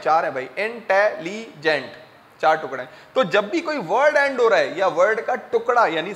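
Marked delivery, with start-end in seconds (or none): 0.6-1.9 intelligent broken into four separate syllables; split into syllables. Shown as a demonstration. Teacher showing four pieces, intelligent syllable by syllable. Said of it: "कितने टुकड़े हैं".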